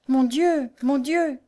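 high, anguished plea; distressed exclamation. A narrator's reading. Poor blind girl revives in pain, distressed. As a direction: cry out in pain and dread. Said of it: "s'exclama la pauvre Louise".

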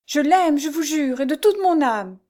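high, impassioned oath; desperate vow. A narrator's reading. Jeanne swears to her abductor, desperate. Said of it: "avec l'énergie du désespoir".

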